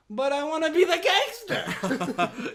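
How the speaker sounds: high, loud, drawn-out complaint; hammed-up pleading, slow and high; mock whiny protest. Actor protesting to directors, mock whining. Protesting the English-accent request.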